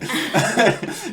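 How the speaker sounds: high, loud burst of laughter; big laugh after his own joke. Real, not acted. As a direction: crack up at the punchline.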